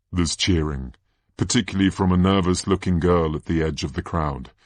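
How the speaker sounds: ordinary delivery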